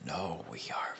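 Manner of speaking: low monster voice, cutting in; Venom. Acted. Venom cutting off Carnage, low creature voice. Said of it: "Venom ki-, cuts him off".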